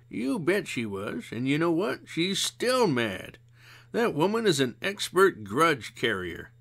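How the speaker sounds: a hint of a weary old friend; mild tired sigh of a voice; Missus Zimmerman. A narrator's reading. Zimmerman chuckling, explains.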